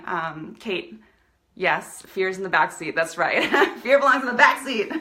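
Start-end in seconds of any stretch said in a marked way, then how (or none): 3.8-5.0 loud line broken by real laughter; laughing. Real, not acted. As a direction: laugh through the words, louder. Laughing reply to a viewer.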